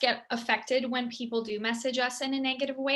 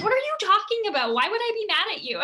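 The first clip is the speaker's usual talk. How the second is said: higher, quick, puzzled; baffled sister; 9 semitones higher, 5 dB louder, faster than usual. Retold or imitated. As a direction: brush it off as if the worry is absurd. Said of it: "She's like".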